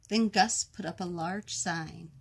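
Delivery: ordinary delivery, nothing marked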